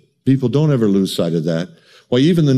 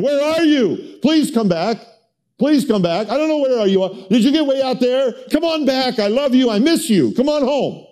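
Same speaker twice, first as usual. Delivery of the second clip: much higher, begging voice; God crying out; 12 semitones higher than usual. Retold or imitated. God crying out for his children, high pleading. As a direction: beg your runaway children to come home. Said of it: "God crying out for his children".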